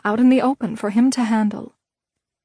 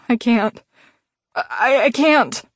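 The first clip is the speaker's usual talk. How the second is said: trembling fear; slower than usual. A narrator's reading. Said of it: "He quivered".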